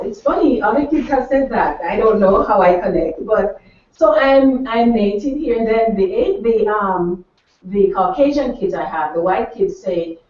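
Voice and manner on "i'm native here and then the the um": ordinary talk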